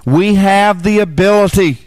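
high, loud fervent preaching voice; full-force preaching. Acted.

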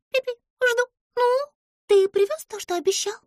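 high squeaky voice, quick and fussy; Pimpochka, fussy little creature. A narrator's reading. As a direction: play the tiny nervous girl. Pimpochka demanding. (высокий писклявый голос)

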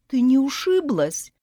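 put-on high, concerned young man asking; caring fiancé checking on her; caring question. A narrator's reading. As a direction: ask gently as if worried. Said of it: "заботливо поинтересовался Ужик".

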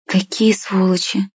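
slow, drawn-out disgust; breathed out, appalled. A narrator's reading. Mashka, leaving the building, exhales it in slow disgust. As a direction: let it out slowly on a sigh. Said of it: "выдохнула Машка".

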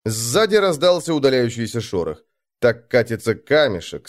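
ordinary delivery

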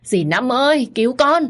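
high, loud terrified cry for help; girl pinned down screams for the aunt, terrified; screaming for help. A narrator's reading. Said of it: "chỉ dám kêu người mà tôi tin tưởng".

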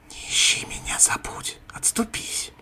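creeping nightmare whisper; a demon hissing from the dark. Acted. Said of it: "шипя добавила кобра Фохт".

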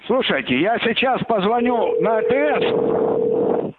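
ordinary talk

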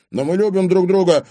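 loud, high-pitched objection; protesting. A narrator's reading. Young man defends his love to her father, protesting. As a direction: protest against the ban. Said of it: "запротестовал парень".

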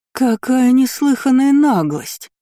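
high, loud, slow indignation; outraged roar. A narrator's reading. Lady is outraged for her son, roaring. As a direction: cry out as a scandalised lady. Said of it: "взревела леди Эйренберг".

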